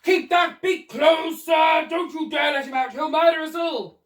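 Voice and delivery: loud, high, slow bellow; frightened bellow. Acted. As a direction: order it in a panic. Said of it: "he bellowed".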